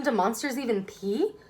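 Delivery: usual speaking voice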